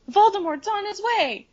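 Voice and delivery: high, frantic warning cry; Harry panicking. Retold or imitated. Harry bursting in to warn everyone, high alarm. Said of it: "Harry is like".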